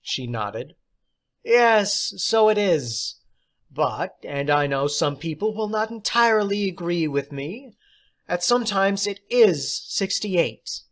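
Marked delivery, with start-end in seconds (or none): 1.5-10.8 high, louder teacher's voice; theatrical teacher. Acted. Substitute teacher praising John, high and theatrical. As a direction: play the odd substitute, grand and pleased. Teacher holds strange claim.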